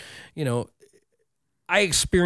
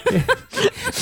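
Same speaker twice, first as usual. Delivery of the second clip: squealing laugh; high shrieking laugh. Real, not acted. Laughing fit after the snort.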